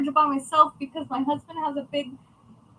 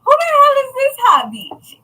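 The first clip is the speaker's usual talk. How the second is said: excited greeting; 11 semitones higher, 11 dB louder than usual. Real, not acted. Greeting live viewers, loud and high.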